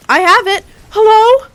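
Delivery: loud, high-pitched shout; calling out. Real, not acted. Streamer looking for opponents in game, loud calling out.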